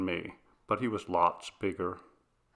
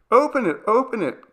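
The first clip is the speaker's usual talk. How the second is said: put-on high, loud eager child's cry; a kid bouncing at the door, pleading; excited child; 14 semitones higher, 8 dB louder than usual. A narrator's reading. Little girl tugging at the front door, high excited cry. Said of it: "Carol cried".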